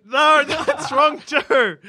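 slow, high, laughing cry of defeat; laughing exasperation. Real, not acted. Host laughing at yet another wrong intro line. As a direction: laugh it out as the take falls apart.

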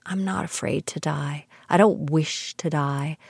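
ordinary delivery

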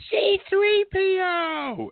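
very high, drawn-out squeal; stretched, high-pitched child's cry; imitated little girl. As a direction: mimic a thrilled small child calling out slowly. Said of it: "this little girl just pointed".

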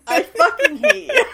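very high and loud, laughing hard; laughing outburst. Real, not acted. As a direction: squeal it out through laughter. Laughing after being teased.

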